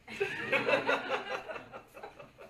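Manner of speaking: high breathy laugh at the joke; real amused laugh. Real, not acted.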